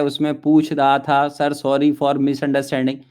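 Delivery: slow, lightly voiced student excuse; mock student reading. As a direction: drag it out like a timid student typing an apology. Teacher reads student's chat message.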